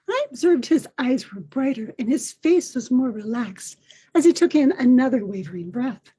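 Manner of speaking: ordinary delivery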